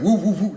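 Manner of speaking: short, loud, high woops; rapid bursts like a squad car siren; quick vocal siren; imitated siren whoops.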